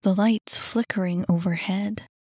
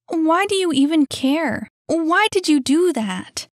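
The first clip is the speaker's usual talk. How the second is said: high and loud, affronted; indignant questioning; 9 semitones higher, 6 dB louder than usual. Acted. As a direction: challenge the kid indignantly. Annie quarrels with kid.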